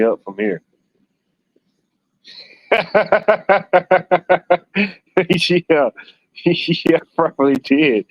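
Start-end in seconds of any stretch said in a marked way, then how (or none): 2.7-4.9 high, sustained giggling laugh; real burst of high laughter. Real, not acted. Laughs at a chat comment. 5.7-8.1 real chuckle running into the words; slightly raised, giggly admission; amused. Real, not acted. Amused agreement amid laughing.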